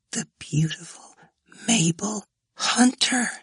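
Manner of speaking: high, breathy awed whisper; fascinated murmur. A narrator's reading. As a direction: whisper it, spellbound by the picture. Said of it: "murmured as if fascinated".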